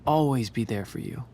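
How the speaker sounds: low, earnest comforting voice; tender reassurance. Acted. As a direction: reassure gently, with pauses between phrases. Captor comforts her, believing her confession.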